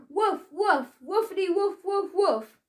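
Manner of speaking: high, playful barks; barking. A narrator's reading. Jake barking from above along with Billy. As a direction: bark along like a happy dog. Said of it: "joined in Jake from above".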